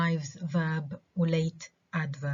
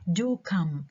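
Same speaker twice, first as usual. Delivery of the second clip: raised "do", falling "come"; stress on DO. Shown as a demonstration. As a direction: urge with a stressed "do". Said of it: "عملت stress على do".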